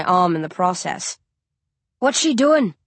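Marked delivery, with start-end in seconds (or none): 2.0-2.7 higher, louder alarmed call; young hunter calling out, pitched up; cry out. Acted. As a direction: call out anxiously across the shop. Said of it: "I hear Tag cry".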